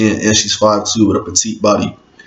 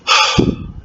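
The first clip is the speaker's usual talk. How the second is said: weary sigh. Acted. After she throws up.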